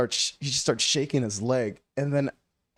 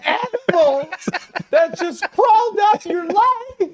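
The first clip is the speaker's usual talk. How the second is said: high, loud, incredulous mock screech; freaking out; 6 dB louder than usual. Friend retelling how he lost his mind, freaking out.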